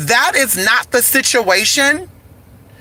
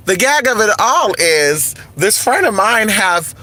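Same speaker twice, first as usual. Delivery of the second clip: ordinary talk, nothing marked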